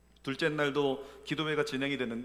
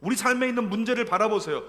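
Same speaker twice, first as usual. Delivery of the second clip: raised, louder preaching voice; fervent preaching; 6 semitones higher, 7 dB louder than usual. Acted. Pastor urging the congregation, fervent preaching. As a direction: drive the point home to the pews.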